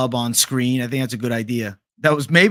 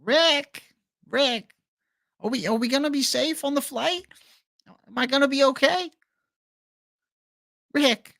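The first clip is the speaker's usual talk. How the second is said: put-on much higher, anxious stammering boy; Morty impression; 11 semitones higher than usual. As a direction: ask Rick nervously like Morty. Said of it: "Do a Morty impression".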